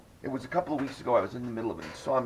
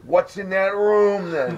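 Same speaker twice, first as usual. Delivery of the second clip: slow, high, loud frightened question; freaked out; 9 semitones higher, 6 dB louder, much slower than usual. Retold or imitated. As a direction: drag each word out in alarm. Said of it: "I freaked".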